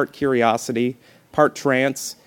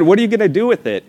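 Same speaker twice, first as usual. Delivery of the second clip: fast, higher, louder disbelieving question; incredulous; 8 semitones higher, 6 dB louder, faster than usual. Retold or imitated. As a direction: blurt it out in disbelief. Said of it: "And then I said".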